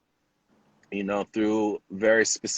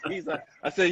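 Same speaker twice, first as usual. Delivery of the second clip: ordinary talk, nothing marked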